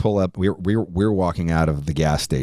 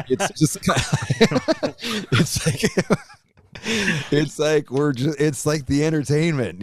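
real laughter breaking through high words; can barely get the words out for laughing; laughing hard; 10 semitones higher, slower than usual. Real, not acted. Telling the host about the gas station fight, laughing hard.

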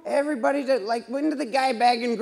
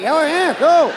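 mock delight; 5 semitones higher, 6 dB louder, slower than usual. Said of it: "I was like".

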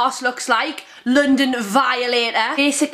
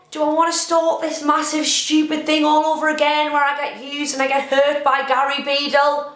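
ordinary talk

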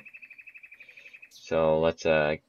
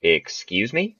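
ordinary delivery

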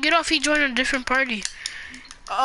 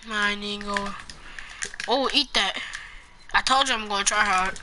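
ordinary talk

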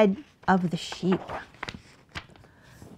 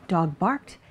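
ordinary delivery

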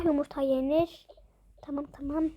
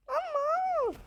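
high doll voice; 12 semitones higher than usual. Acted. Doll character speaks.

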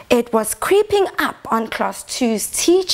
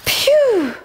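relieved phew; 10 semitones higher than usual. Acted. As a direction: blow out a big phew of relief. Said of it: "said class two".

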